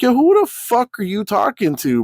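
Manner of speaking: high-pitched, incredulous challenge; indignant retort. Retold or imitated. Arguing back at a chat member, indignant high retort. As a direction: fire back in disbelief, pitch jumping up. Acted quarrel, snapping back.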